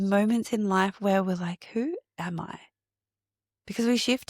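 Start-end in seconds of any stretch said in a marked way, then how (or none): 1.7-2.6 quiet wondering. Retold or imitated. Inner question voiced mid-talk.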